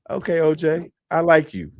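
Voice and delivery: high, laughing-edged approval; amused drill sergeant. Retold or imitated. Drill sergeant warming to a cheeky recruit. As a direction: grin through the words. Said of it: "he looks at me like".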